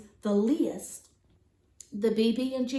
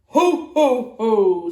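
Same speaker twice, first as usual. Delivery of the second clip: loud booming ho ho ho; belly-laugh like Santa; jolly ho ho ho; 2 semitones lower, 10 dB louder than usual. Acted. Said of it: "Santa laughed".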